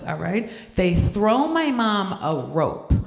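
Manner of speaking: ordinary talk, nothing marked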